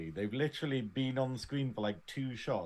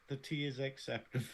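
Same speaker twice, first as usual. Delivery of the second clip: softly mimicked icy verdict; cold, stony imitation; 7 dB quieter than usual. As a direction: deliver it quietly, with cool disdain. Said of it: "the fucking cold stone bitch that she is".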